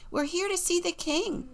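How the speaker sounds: high, hopeful Jack voice; high-pitched storybook boy; young Jack. Acted. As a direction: announce the visit as eager Jack. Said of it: "Jack said".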